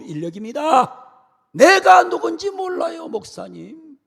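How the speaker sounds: loud, high, offended tirade; angry outburst. Acted. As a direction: blow up at the pastor. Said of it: "화를 버럭 내면서".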